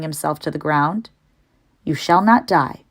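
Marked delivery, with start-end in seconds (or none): none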